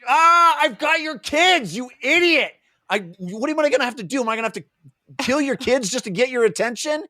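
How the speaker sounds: high-pitched yell easing into exasperated pleading; exasperated mock yell. Olar Ithil yelling at an oblivious Tool for attention, exasperated. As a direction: burst out loud and high, then keep ranting high.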